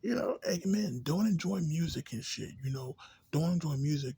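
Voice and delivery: sarcastic mock order. Mocking the locals to his viewers, loud sarcastic order.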